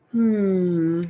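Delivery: low, loud, drawn-out solemn hum; host humming solemnly before the verdict; solemn hmm. A narrator's reading. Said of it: "he mumbled solemnly".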